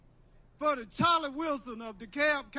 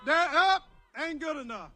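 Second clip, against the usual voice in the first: ordinary talk, nothing marked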